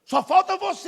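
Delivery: single him out across the room; calling out the young man. Retold or imitated. Pastor calling out the young man, loud ringing call. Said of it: "começou a falar assim".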